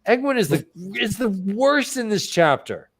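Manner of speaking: ordinary talk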